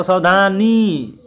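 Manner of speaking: सावधानी said slowly, the final ई held long; stretched-out सावधानी to show the long ई; long ई sound. Shown as a demonstration. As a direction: draw out the नी at the end of सावधानी. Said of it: "बड़ा ई का उच्चारण किया जा रहा है".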